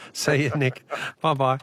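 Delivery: normal talking voice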